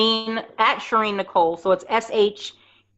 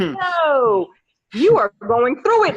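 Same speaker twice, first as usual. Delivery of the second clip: higher and louder insistence; insistent retort; 6 semitones higher, 4 dB louder, much slower than usual. Retold or imitated. Telling a struggling friend she needs help, insistent. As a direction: firmly tell her she's suffering, higher and louder. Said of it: "And I was like".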